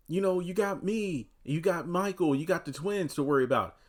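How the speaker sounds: imitating a worried reminder; imitated pleading. Voices Fatima pleading with Zach.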